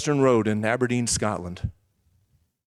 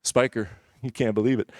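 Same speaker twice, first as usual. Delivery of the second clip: usual speaking voice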